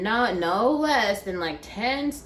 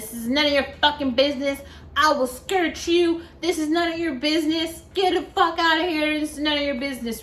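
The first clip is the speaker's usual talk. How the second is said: higher-pitched retold telling-off; imitated angry man; 7 semitones higher than usual. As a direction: mimic a man angrily telling her off. Angry man confronts woman on bus.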